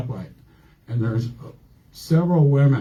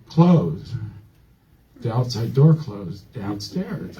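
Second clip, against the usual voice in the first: ordinary delivery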